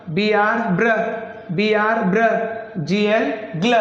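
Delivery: English letter names, then the fused sound: प्ल, क्ल, ग्र; measured drill of letters into blends, ending each on प्ल, फ्र or ग्ल; consonant blend demo. Shown as a demonstration. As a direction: spell out the pair, pause, say it as one syllable like ब्ल. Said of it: "English में हम ऐसा समझ लेते हैं".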